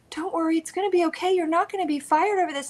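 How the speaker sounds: high-pitched, patronizing reassurance; condescending coworker. Retold or imitated. Imitating the man talking down to her, condescending. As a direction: mimic him soothing you like a child. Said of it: "he was talking down to me".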